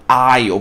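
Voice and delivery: aisle said like "I'll", silent s, low falling; aisle, no s sound at all; correct English pronunciation. Shown as a demonstration. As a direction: say aisle as one syllable, ail. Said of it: "Pode repetir em voz alta comigo".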